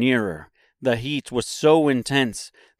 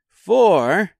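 higher, louder earnest character voice; Tin Woodman's voice; 9 semitones higher, 7 dB louder than usual. A narrator's reading. Woodman reasoning to himself, earnest high voice.

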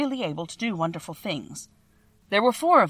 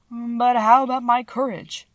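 slow, higher, louder worried question; anxious Lion; 5 semitones higher, 5 dB louder, slower than usual. Acted. Cowardly Lion begs Oz for courage, anxiously. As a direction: ask it with a tremble of worry. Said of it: "asked the Lion anxiously".

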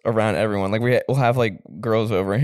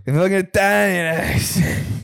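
mock New York Italian; 6 semitones higher, much slower than usual. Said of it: "And then we have our".

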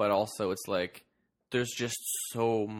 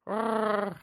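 Wookiee gurgle; 12 semitones higher than usual. Retold or imitated.

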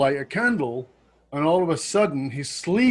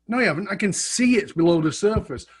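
normal talking voice

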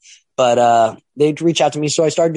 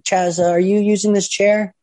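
higher, slow, meek asking voice; mock a nervous guy asking timidly, higher and slow; timid wrestler; 10 semitones higher than usual. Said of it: "He's like".

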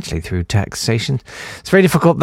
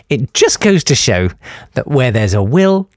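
ordinary delivery

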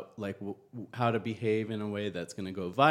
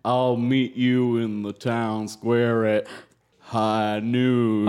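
slow, louder Western drawl; cowboy voice; 5 dB louder, slower than usual. Pretend. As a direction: challenge him to a duel at noon. Cowboy bong challenging to duel.

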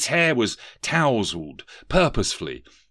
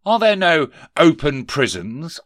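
higher, louder boss voice of a sneering Scrooge; stern miser boss; 7 semitones higher, 7 dB louder than usual. Acted. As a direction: bark it down at the clerk. Scrooge questions collector.

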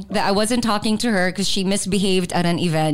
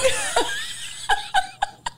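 burst of laughter; 4 dB quieter than usual. Real, not acted. Mother laughing at daughter.

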